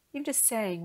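normal talking voice

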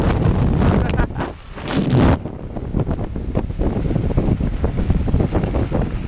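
giggling laugh. Real, not acted. After scaring Katie.

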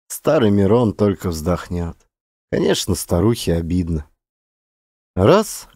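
usual speaking voice

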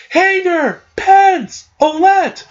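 high-pitched drawn-out mock cry. Roxas calls to his three friends, crying out. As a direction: call each name high, one by one.

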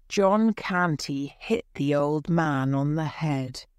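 ordinary delivery

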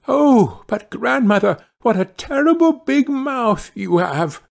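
put-on very high, startled child; high-pitched mounting astonishment; alarmed girl. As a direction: gasp out the words in dismay. Girl alarmed by big mouth.

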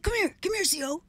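lightly imitated high-pitched urging; inmate beckoning.

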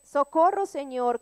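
grita socorro alto, como pidiendo auxilio; un clamor fuerte al Señor. Retold or imitated. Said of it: "otro versículo dice". (clamor fuerte de auxilio)

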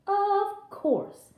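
put-on high-pitched little girl's reply; high, willing girl voice; eager Little Red. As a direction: answer brightly as an obliging child. Little Red answers her mother.

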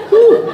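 sudden high, loud whoo; loud falsetto whew; whoop. Acted. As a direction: let out a short high-pitched whoop. Exclaims after his battery joke.

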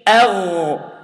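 أغ with a strong throaty gh; ghain demo. Shown as a demonstration.

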